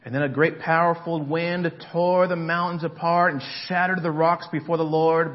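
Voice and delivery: loud, building preacher reading of scripture; sermon reading with rising force; preaching. Acted. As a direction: proclaim the storm over the crowd.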